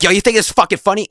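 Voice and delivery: loud, high, fast confrontational outburst; imitated anger. Angry friend confronts the giggling classmate before swinging, imitated shout. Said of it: "he's still mad".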